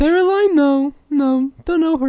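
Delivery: very high, loud squeak; squeaky ghost voice. Acted. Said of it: "You sound kinda like".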